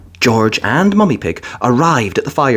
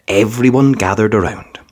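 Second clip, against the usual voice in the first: usual speaking voice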